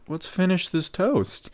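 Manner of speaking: placating. Retold or imitated. Host trying to smooth things over.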